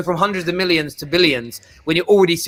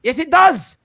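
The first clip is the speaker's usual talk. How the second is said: insistent retort; 7 semitones higher than usual. Real, not acted. Argument rebuttal.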